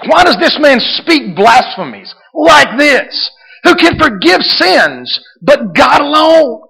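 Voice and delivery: high, loud, slow shouted quote; excited outcry. Acted. Preacher voicing scribes accusing Jesus of blasphemy, shouted excitedly. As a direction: cry it out as the indignant scribes. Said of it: "so excited I can't even speak".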